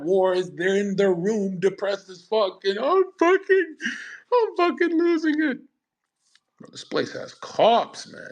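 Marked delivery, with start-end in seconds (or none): 2.8-5.6 mock breakdown. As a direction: ham up a guy cracking in his room. Depressed guy in his room, mocked.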